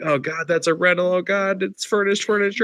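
high, panicked pleading; mock panic. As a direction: beg the dog to stop, half joking. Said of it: "And I was like".

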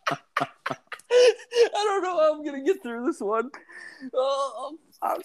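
strained high voice, barely holding back laughter; trying to get through the line while cracking up; laughing. Real, not acted. Reading crude slang aloud, fighting off laughter.

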